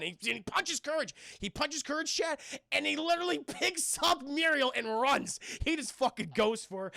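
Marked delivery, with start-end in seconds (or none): none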